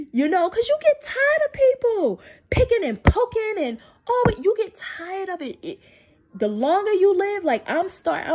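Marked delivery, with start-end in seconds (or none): 0.1-5.6 high, crying voice breaking; weary crying complaint; tearful. Real, not acted. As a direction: near tears, pitch up. Tired of being picked on.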